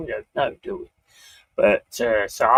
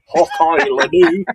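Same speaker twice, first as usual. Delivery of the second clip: loud, drawn-out mock Scottish accent; 4 semitones higher, 6 dB louder, slower than usual. Streamer joking about being taken for Scottish, mock Scottish. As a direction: ham up a Scottish catchphrase. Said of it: "Is that a Scottish accent?".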